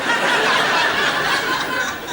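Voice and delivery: high burst of laughter; loud laugh. Real, not acted. Teacher laughs at joke.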